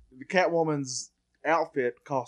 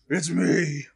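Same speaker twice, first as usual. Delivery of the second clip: sick voice; 2 semitones higher, 4 dB louder than usual. Acted. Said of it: "somebody who has a sick voice again".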